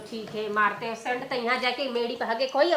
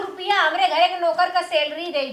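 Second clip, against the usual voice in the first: loud boast; 10 dB louder than usual. Acted. Bragging about servant's salary.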